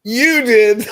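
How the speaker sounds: high, loud accusing shout; mock friend accusing. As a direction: fling the blame back at him, loud and high.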